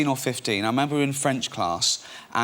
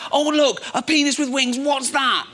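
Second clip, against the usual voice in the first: much higher, louder sarcastic teacher; mock-outraged teacher; 13 semitones higher, 4 dB louder, slower than usual. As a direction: sneer it up high at the pupil. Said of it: "And he went".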